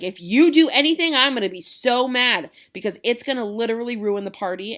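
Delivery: loud, high modern rant; angry paraphrase. Retold or imitated. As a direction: yell the warning. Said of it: "He's like".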